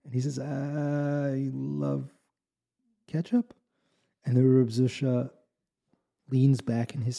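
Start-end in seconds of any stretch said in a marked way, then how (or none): 0.4-3.5 uncertain question. Acted. Innkeeper repeats, unsure.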